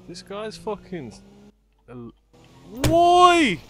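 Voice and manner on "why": yelled question, much higher and louder; real shout. Real, not acted. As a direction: shout it in disbelief. Chaos in game fight.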